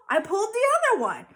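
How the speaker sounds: quick, high, loud smart answer; proud little loophole; fast cheeky confession. A narrator's reading. Cheeky reply.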